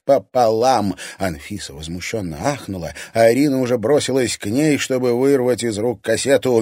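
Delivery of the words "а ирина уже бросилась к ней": plain reading voice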